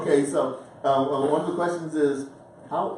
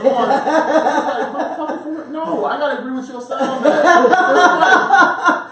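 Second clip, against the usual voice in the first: high loud laughter over the words; laughing agreement with the son; 11 semitones higher, 11 dB louder, slower than usual. Real, not acted. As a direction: crack up and squeal it.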